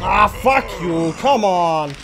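loud angry swearing at the game; furious shout. Real, not acted. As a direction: curse the screen in full rage. Raging at game.

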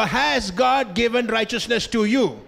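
ordinary delivery

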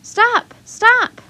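high, loud shouted cries; urgent shout. A narrator's reading. Child shouting to halt a departing wagon, urgent shout. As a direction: call out sharply to halt someone.

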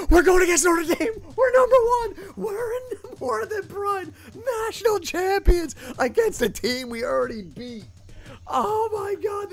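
high, loud, breathless disbelief; real overjoyed yelling, way up high; excited shout. Real, not acted. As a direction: scream the news to your friends. Learns team plays for title.

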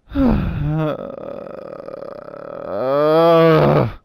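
long exhale swelling into a groan; drawn-out sigh rising into a moan; frustrated groan. Real, not acted. Frustrated after losing.

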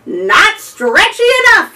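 high, loud bellow. A narrator's reading. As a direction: bellow in frustration. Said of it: "he bellowed".

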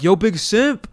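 loud, high call across the path; yell. Retold or imitated. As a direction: shout his name to get the runner's attention. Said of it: "So I yell".

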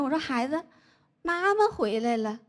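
ordinary talk

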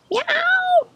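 very high cat cry; piercing musical meow; sung meow. A narrator's reading. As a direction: let out a high meow mid-song. Meow inside the final song.

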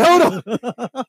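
high-pitched imitation of panic; mock frantic call to stop; panicked retold yell. Removal guy begging the others to stop, panicked.

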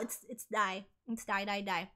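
usual speaking voice

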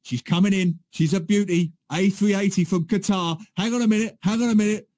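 imitated live commentary, breaking off at an interruption; Jerry Dyer. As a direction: commentate eagerly, then get sidetracked. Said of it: "He'll go".

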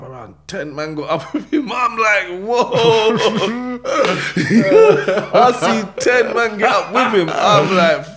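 loud, high-pitched, cracking up; helpless laughing outburst, words stretched out; laughing in disbelief. Real, not acted. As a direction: tell it through real laughter, voice shooting up. Laughing at his own retold shock.

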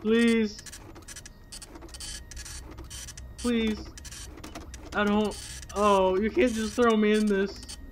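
pleading. Real, not acted. Pleading with the scary game.

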